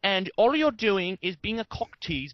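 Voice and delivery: mock British accent. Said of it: "not necessarily in the British accent".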